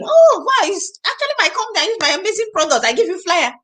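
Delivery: high, eager sales pitch; excited pitch. Pretend. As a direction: brightly pitch your product to a stranger. Acting out explaining to a stranger.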